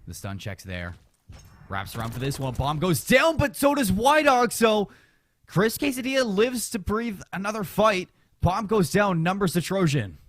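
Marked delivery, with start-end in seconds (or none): 2.5-10.2 excited esports caster. Real, not acted. Caster reacts to a bomb plant and a kill.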